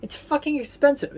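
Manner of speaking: sky-high disbelief over an eighty-dollar dish; outraged exclaim. Real, not acted. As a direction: let the outrage at the bill lift the voice high. Outraged at the restaurant prices.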